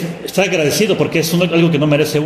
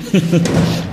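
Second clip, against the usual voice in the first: breathy chuckle; 4 semitones higher than usual. Real, not acted. Laughs at own joke.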